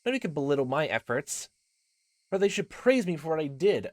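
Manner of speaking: proud game heroine. Acted. Reina defending her efforts, proud. Voices game character's lines.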